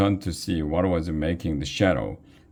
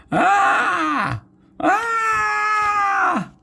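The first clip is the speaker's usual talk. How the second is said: very high shrieking scream, twice; terrified scream. A narrator's reading. Dodwin running from the giant, shrieking scream. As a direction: shriek in pure panic. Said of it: "screamed Dodwin".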